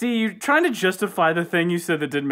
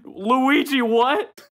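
lightly voiced friend, higher; a hint of Jacob, higher and louder; 8 semitones higher, 3 dB louder, much slower than usual. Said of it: "the voice of Jacob".

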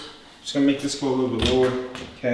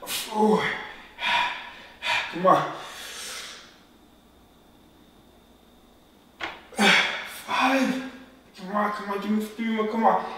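strained high counting, pushing hard; counting workout reps; 11 semitones higher, 4 dB louder than usual. Real, not acted. Straining through the last reps.

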